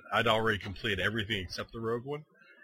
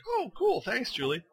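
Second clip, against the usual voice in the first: excited thanks; 12 semitones higher than usual. Retold or imitated. Retold thanks to Julie.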